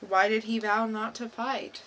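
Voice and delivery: ordinary talk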